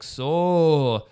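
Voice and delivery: slow, loud, bad Russian accent. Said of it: "that's a really bad Russian accent".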